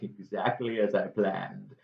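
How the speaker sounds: a hint of a scheming villain; villain gloating. Don John pleased his plot worked, soft villain gloat. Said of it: "Don John's like".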